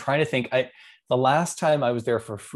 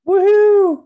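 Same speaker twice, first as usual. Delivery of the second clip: cheer; 14 dB louder than usual. Real, not acted. Cheering Tom Brady's Disney trip.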